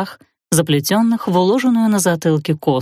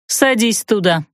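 холодно; 3 semitones higher than usual. Acted. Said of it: "холодно приказала ему хозяйка".

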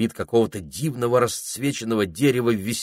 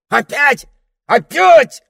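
high, loud shout, rising in fury; angry; 14 dB louder, slower than usual. A narrator's reading. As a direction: explode in exasperation, louder the second time. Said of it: "разозлился попугай".

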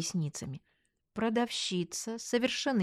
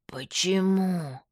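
drawn-out, very slow numb-lipped question; slow, slurred suspicion; wary numb-lipped friend; 3 dB louder, much slower than usual. A narrator's reading. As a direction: ask warily, stretching the word through a numb lip. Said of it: "Машка насторожилась".

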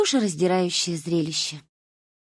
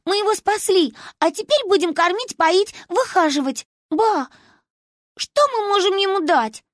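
put-on high, eager girl's voice; bright-eyed childish enthusiasm; victorious child; 12 semitones higher, 4 dB louder than usual. A narrator's reading. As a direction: announce the plan to grandma, pitched way up. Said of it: "победно сказала Манька".

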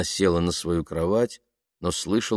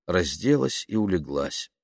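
ordinary delivery, nothing marked

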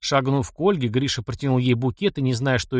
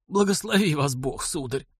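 hoarse blessing; 3 semitones higher than usual. A narrator's reading. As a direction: bless gratefully in a hoarse elderly voice. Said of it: "хрипло сказав".

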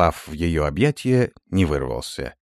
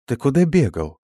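puzzled, rising question; surprised; 8 semitones higher, 3 dB louder than usual. A narrator's reading. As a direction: caught off guard. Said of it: "и удивлённо спросила". (удивлённо)

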